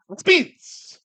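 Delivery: high-pitched loud outburst; sudden shout. Acted. Streamer capping his against-everything list, sudden high yell.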